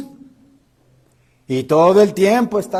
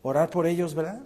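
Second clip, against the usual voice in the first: usual speaking voice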